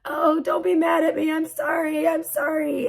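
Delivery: high, whiny mock-apologetic kid; upset child. Daughter apologizing to stressed mom, upset and whiny. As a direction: imitate a kid pleading for forgiveness. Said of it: "she gets so upset and she's like".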